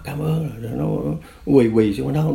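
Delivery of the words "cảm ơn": high, understated imitated gratitude; tearful thanks. Spared cadre thanking his former prisoner, mildly imitated weeping. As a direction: thank him as if through tears, lightly acted. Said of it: "Thì nó khóc quá".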